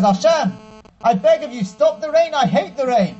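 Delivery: put-on a small boy pleading with all his heart; child pleading.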